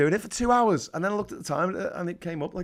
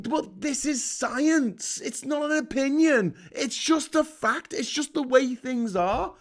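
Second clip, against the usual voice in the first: higher-pitched, drawn-out pleading; exasperated insistence; 8 semitones higher, slower than usual. Acted. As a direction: argue the obvious, slowly and high. Acted argument with science deniers.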